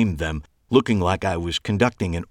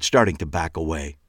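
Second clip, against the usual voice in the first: ordinary delivery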